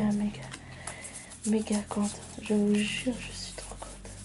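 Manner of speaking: soft, hushed gushing of delight; can barely contain her joy, breathing it; overjoyed whisper. Real, not acted. Thrilled by her pull, she whispers her joy to viewers.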